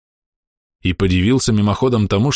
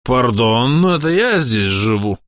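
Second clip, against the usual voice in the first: put-on town drunk; 3 semitones higher, slower than usual. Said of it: "пьяным голосом запротестовал Медников".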